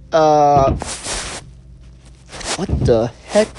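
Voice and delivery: mic cutting out, garbled; call dropping out. Real, not acted. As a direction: audio breaking up. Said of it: "my mic cut out here".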